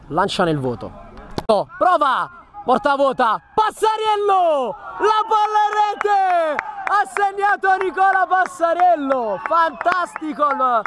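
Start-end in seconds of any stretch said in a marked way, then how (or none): 1.5-10.8 high, stretched goal shout; breathless italian goal commentary. Real, not acted. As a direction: scream the goal call, drawing out each word.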